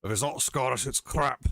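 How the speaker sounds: put-on Scottish accent, hammed up. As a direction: do a jokey Scottish impression. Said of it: "do a Scottish accent".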